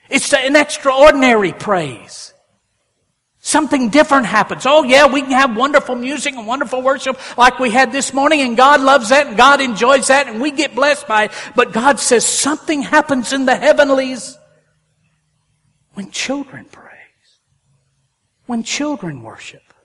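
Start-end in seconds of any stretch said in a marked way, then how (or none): 0.1-14.4 louder, higher, fervent preaching; preaching at full force. Acted. 15.9-17.4 sudden whisper. Acted. Hushed after loud preaching.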